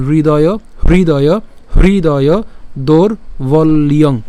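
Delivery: হৃদয় repeated firmly, then দৌড় বল ইয়ং in slow parts; pronunciation model. Shown as a demonstration. Teacher modelling for learners, হৃদয় and দৌড় বল ইয়ং slow and emphatic. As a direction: sound the "hri" in হৃদয়, separate দৌড় বল ইয়ং. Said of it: "কীভাবে উচ্চারণ করবেন?".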